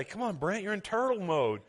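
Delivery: high, lightly mocked coaxing; imitated nagging wife. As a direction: lightly imitate a wife chiding her husband. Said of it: "You used to have to say".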